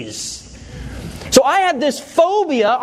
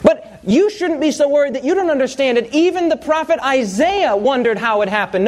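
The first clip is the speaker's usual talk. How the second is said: ordinary talk, nothing marked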